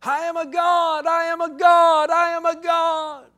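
high, loud mock shout, dragged out at the end; mock screaming. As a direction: shriek it like a woman lost in New Age ecstasy, hammed up. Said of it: "started screaming".

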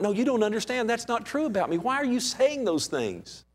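high, pleading whine; whiny protest. Pretend. Preacher mimics a listener whining, high and hurt. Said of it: "And you're going".

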